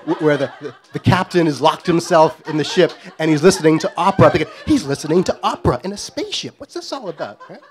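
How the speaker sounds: usual speaking voice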